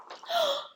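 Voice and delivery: sharp gasp of alarm; shocked gasp. Acted. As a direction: gasp as if the caps are gone. Reader discovers monkeys.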